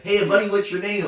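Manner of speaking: high, loud, breezy American "Hey, buddy"; mock American. Imitating the American owner greeting a fan, high and loud. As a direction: ham up a friendly American greeting, pitched high. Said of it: "But he's so American".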